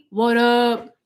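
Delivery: playful put-on gangster greeting; lightly hammed-up street-slang hail; a hint of gangster swagger; mock gangster. Shown as a demonstration.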